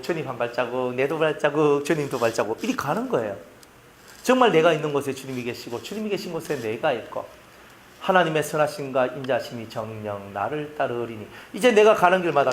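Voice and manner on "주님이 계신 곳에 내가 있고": normal talking voice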